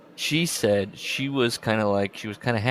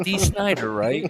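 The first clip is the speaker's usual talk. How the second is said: mock clueless high; 7 semitones higher, 4 dB louder, slower than usual. Acting out his own confusion.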